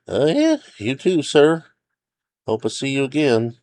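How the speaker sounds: high, uncertain ranger voice; park ranger character. A narrator's reading. Ranger bids hiker farewell, uneasy and high.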